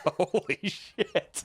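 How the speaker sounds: slow, drawn-out, put-on voice; mock shock. Listener stunned by the kid, slow mock shock. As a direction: let the shock hang. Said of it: "you're like".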